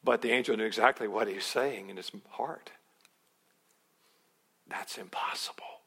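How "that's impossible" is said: hushed private doubt; soft unvoiced disbelief; whispered disbelief. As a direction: whisper it to yourself. Zechariah's inner disbelief.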